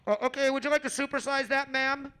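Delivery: put-on fast-food server voice; drive-through clerk. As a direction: ask it like a drive-through worker. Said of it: "And the person's like".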